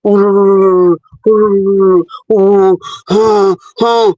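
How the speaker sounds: low, droning whooshing noise; vocal mimicry of a low rumbling whoosh; imitated womb sounds. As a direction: imitate the scan's rolling "wurururu", low and drawn out. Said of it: "eh, the nwa na-eme".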